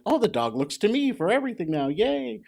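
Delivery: quick, high, giddy; mock delight. A handler mimicking his own giddy pride in the dog, high and fast. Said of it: "you're like".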